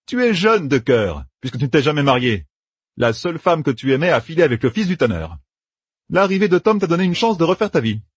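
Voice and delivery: planning aloud with brisk confidence; a hint of a young general laying out plans; Matt's voice. A narrator's reading. Narrator voices Matt.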